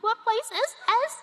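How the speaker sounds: mock-appalled big-city newcomer; incredulous. Shocked at a tiny zoo.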